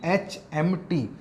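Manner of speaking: "एच एम टी" read slowly as separate letters. Shown as a demonstration. Teacher reads an abbreviation to students, letter by letter. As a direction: spell "एच एम टी" one letter at a time. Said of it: "इसको हम क्या पढ़ते हैं".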